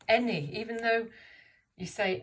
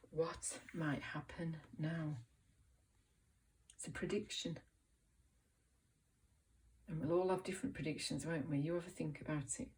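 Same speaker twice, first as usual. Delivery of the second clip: normal talking voice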